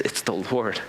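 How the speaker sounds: a soft, low, awed aside; quiet awe to the friend beside him; hushed aside. Retold or imitated. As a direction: lean in and say it under the breath. John leans over to Peter.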